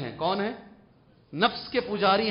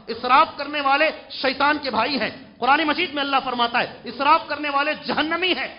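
raised, emphatic preaching voice; forceful warning; 7 semitones higher, 8 dB louder than usual. Acted. Preacher warning wasteful people from scripture, forceful warning. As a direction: thunder the warning at the congregation.

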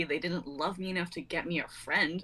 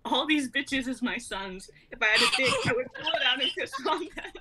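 laughing bravado; 5 semitones higher, 3 dB louder than usual. Acted. Cat boasting [name] lyric.

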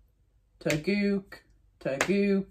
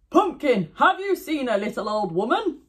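tiger voice; 7 semitones higher, 3 dB louder than usual. Acted. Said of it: "tiger asks pumpkin".

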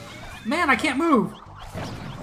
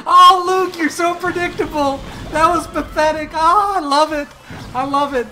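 much higher and louder, cackling mockery; laughing taunt; 8 semitones higher, 7 dB louder than usual. Real, not acted. Gamer mocking opponent Luke after beating him, laughing loudly. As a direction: laugh right in his face, teasing.

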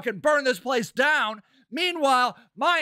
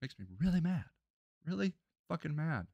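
very low, soft, spent anger; quietly admitting real anger; subdued anger; 8 semitones lower, 12 dB quieter, faster than usual. Real, not acted. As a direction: drop right down, still mad. Spent after an angry outburst.

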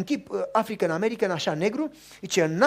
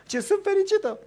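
high, tearful happy voice; a woman weeping with joy; lightly mimicked tearful joy; crying woman; 8 dB louder than usual. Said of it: "și plângea".

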